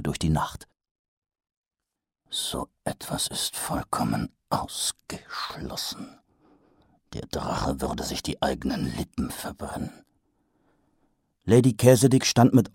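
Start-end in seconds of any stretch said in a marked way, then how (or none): 2.3-10.0 low, soft, hushed disbelief; whispered doubt. A narrator's reading. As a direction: breathe it out in disbelief. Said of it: "Mumm's doubting thoughts".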